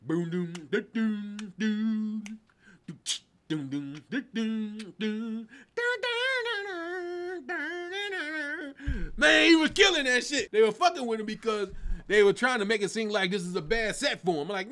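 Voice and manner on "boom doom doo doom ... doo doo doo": scatting the drum and synth breakdown; vocal beatbox of the breakdown; imitating the breakdown. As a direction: mouth the beat of the breakdown. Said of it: "something about that breakdown".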